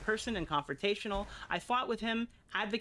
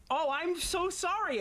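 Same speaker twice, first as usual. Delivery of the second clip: sarcastic apology; 9 semitones higher, slower than usual. Acted.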